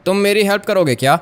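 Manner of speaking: ordinary talk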